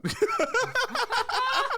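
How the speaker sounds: high-pitched burst of real laughter; laughing. Real, not acted. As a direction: crack up at your own story. Laughs after own story.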